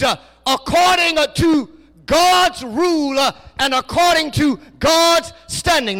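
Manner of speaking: loud, rising preacher's cadence, slow and weighty; preaching at full force. Acted. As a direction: preach it out to the congregation.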